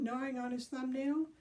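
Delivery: ordinary delivery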